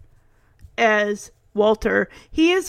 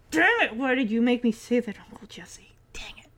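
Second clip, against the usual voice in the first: muttered complaint fading very soft; imitated grumbling; 4 dB quieter, faster than usual. Stephanie blames Uncle Jesse to herself, muttering. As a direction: grumble under your breath, dwindling to a whisper.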